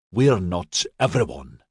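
ordinary delivery, nothing marked

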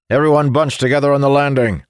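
loud, fast commanding shout. Acted. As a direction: bark orders to the squad. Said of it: "Moradin shouted".

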